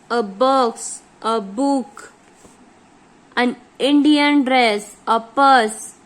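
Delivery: slow, word-by-word exercise reading; teacher dictating sentences at a very slow pace; dictation reading. Acted. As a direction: read each sentence out slowly for the class.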